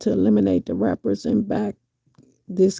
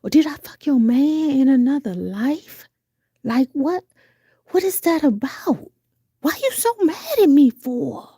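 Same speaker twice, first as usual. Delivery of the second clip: high, fast, exasperated; indignant mock rant; 6 semitones higher than usual. Imitating someone asking a hater why they're so mad. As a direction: demand to know what you did to them. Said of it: "And you be like".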